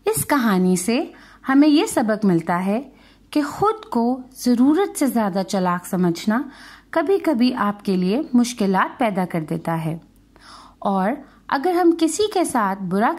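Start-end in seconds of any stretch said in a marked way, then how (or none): none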